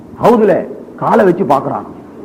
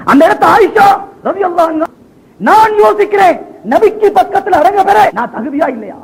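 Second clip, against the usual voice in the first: high-pitched, pleading sermon cry; impassioned preaching; 9 semitones higher than usual. Acted. Preacher voicing Abu Bakr's burial request to companions, impassioned.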